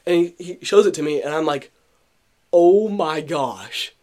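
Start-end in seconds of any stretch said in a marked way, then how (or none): 2.5-3.9 slow, relishing the absurd sight; amused amazement. Retold or imitated. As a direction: savour it, then burst out louder. Retelling own delighted reaction to photo.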